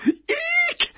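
very high, delighted squeal; happy squeal. A narrator's reading. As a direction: squeal with gleeful disgust. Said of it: "hylte rampete Robin lykkelig".

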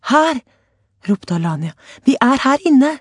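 high, loud calls; shouting. A narrator's reading. Alania calling to the voice outside, loud shout. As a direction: make the rescuer hear.

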